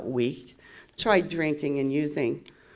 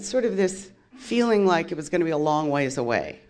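normal talking voice

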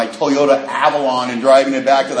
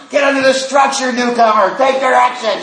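high, louder bossy yell with a laugh; yelling at the deer; 9 semitones higher, 3 dB louder than usual. Retold or imitated. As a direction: order the stubborn deer under the shelter. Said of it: "She's yelling at him".

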